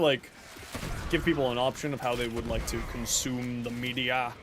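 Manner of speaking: ordinary delivery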